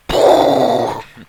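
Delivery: high loud laugh bursting out; loud burst of laughter. Real, not acted. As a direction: crack up loudly at yourself. Joke about his brain.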